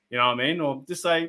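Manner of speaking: ordinary talk, nothing marked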